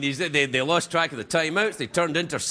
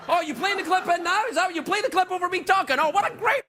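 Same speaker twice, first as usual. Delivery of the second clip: much higher, sarcastic outrage; high indignant surprise; 12 semitones higher than usual. Real, not acted. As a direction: react to the clip with mock outrage. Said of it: "clip played over him".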